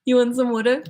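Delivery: "water" said as "wooder", through laughter; local "wooder". Acted. Joking as a local offering a drink, water as "wooder". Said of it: "they say wooder".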